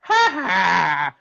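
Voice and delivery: lightly done Zippy puppet squawk; a hint of Zippy, chirpy. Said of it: "it goes into Zippy's voice".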